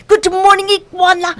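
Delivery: buzzy little pest bellowing good morning; Mosquito shouting. Acted. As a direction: shout the greeting far too loud for sleepy ears. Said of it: "shouted Mosquito".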